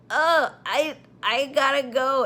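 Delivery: high, loud, flustered stammer; panicked stammer. Retold or imitated. Nurse spattered with blood, panicking to leave. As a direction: blurt it like you need to bolt right now.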